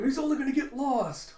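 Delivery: high, loud mock alarm; freaking out. Crew hearing Zoro will go after Luffy, freaking out. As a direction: wail it in exaggerated dismay. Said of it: "they freak out".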